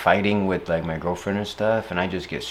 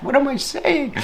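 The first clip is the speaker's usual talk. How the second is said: much higher squeak of disbelief; squeaky self-mockery. Real, not acted. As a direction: squeak at your own nonsense. Laughing at his own silliness.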